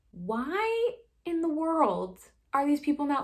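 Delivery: slow, high, loud incredulity; exasperated disbelief. Acted. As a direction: stretch out the baffled question in exasperation. Voices her reaction to thriller characters.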